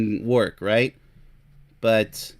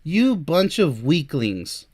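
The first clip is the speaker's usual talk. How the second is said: ordinary talk, nothing marked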